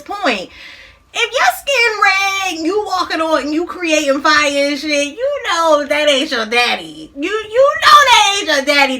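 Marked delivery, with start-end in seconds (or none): 1.1-9.0 heated rant. Acted. Viewer telling the son about his father, heated high rant. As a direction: build to a wailing, exasperated shout. Ranting at the movie's obvious truth.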